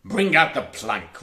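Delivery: loud pirate command; roared. A narrator's reading. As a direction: bellow the order across the deck. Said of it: "roared Hook".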